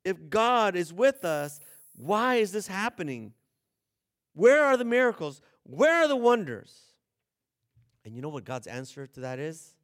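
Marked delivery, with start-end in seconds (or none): 4.3-6.9 loud, high pleading cry; desperate outcry. Retold or imitated. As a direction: call out to an absent God. Crying out to God.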